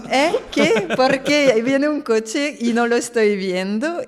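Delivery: loud, high mock bewilderment; acted startled confusion. Reacting to the screaming woman, acted startled confusion.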